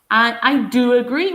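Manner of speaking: emphatic "do" in "I do agree"; stress on do. Shown as a demonstration. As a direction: press the word "do". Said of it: "we can use if we're emphasizing".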